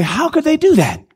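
high-pitched incredulous questions; mock disbelief. Onlookers asking about people who do evil, in mock disbelief.

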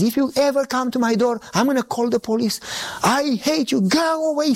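high, agitated threat, retold; screaming neighbor. Neighbor threatening to call the police, screaming. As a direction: mimic a hateful neighbor driving someone off. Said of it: "screaming, calling me names".

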